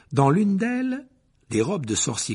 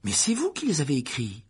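indignant; 3 semitones higher than usual. A narrator's reading. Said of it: "s'indigna Harry".

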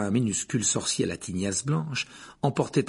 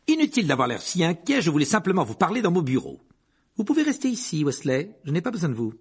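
higher, louder voice of a brisk schoolmistress; strict professor; 7 semitones higher, 4 dB louder than usual. A narrator's reading. Professor dismissing a worried pupil's friend, brisk and strict. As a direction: voice a no-nonsense woman teacher, pitched up.

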